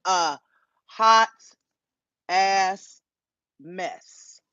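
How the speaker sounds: a, hot, ass, mess, each set apart with pauses; word by word emphasis. Real, not acted. Streamer judging the drama, spacing each word for punch. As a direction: space out every word to drive it home.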